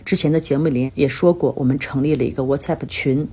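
usual speaking voice

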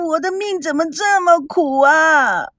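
sobbing through a self-pitying wail; crying. Acted. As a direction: cry out the complaint. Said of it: "你哭什么哭啊". (哭着诉苦)

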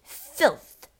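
loud, spat-out single word; father dismissing an American book; angry dismissal. A narrator's reading. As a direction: spit it out with disgust. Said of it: "Mister Wormwood said".

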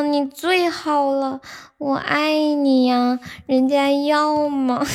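slow drawn-out cutesy begging; cutesy whiny pleading. Pretend. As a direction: coo and whine like a spoiled girlfriend begging. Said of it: "你先来，你给他们带个头".

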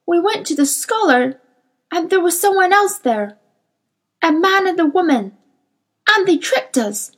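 high-pitched, anxious creature's confession; agitated daemon. A narrator's reading. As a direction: blurt out the story in a high, agitated voice. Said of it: "in his agitation".

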